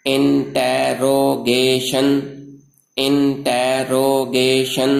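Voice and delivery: slow, chant-like, each syllable drawn out. Shown as a demonstration. Teacher models five-syllable rhyming words for learners, slow syllable by syllable. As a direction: sound out every word in evenly stretched beats. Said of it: "पांच हरकतों से अदा होते हैं".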